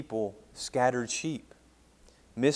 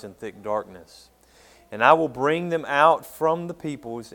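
booming scripture reading; 3 semitones higher, 9 dB louder than usual. Acted. Pastor to his congregation, reading Ezekiel in a loud solemn proclaiming voice. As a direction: declaim the Lord's words to the hall.

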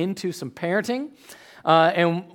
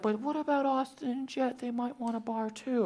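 [name]; 6 semitones higher, 10 dB quieter than usual. Retold or imitated. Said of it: "what happens then is like".

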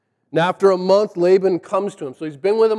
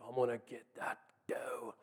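low, very hushed stalker's voice; hunter stalking prey; 5 semitones lower, 18 dB quieter than usual. Retold or imitated. Jacob as a hunter who found his prey, hushed. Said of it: "has become a hunter".

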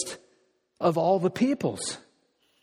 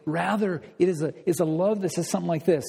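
normal talking voice

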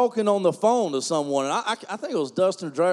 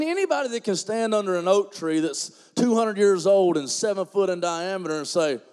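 ordinary delivery

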